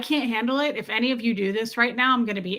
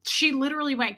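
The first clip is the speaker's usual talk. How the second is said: normal talking voice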